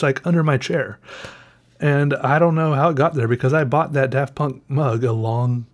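ordinary delivery

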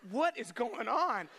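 high, slow, stunned cry; overwhelmed dad losing it; dad's disbelief as chaos piles up; exasperated disbelief. Retold or imitated. Dog pukes while holding baby.

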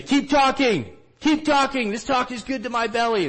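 high, loud, slightly long-held cheer from the seats; imitated approving shout. Villager standing up to approve the teacher, imitated excited shout. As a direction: call it out like a thrilled heckler. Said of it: "they'll stand up".

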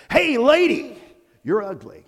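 high, loud squawky parrot voice; squawking parrot. Acted. Pet shop parrot squawking insults at passing lady. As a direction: screech it out like a rude parrot.

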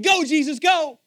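high, loud cheer-on; God's shouted send-off. Acted. God urging Jesus to go, shouting.

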